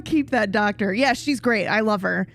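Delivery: normal talking voice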